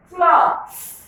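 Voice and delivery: cloth said high and clear for the class; model word. Shown as a demonstration. Teacher modelling a word for pupils, high and clear. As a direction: pitch "cloth" up and hold it clearly. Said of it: "let's go through the word examples again".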